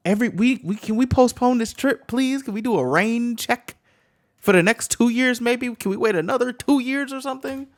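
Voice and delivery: high, loud exasperated begging; mock pleading. Listener begging to postpone the trip, high exasperated plea. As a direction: plead urgently for a way out.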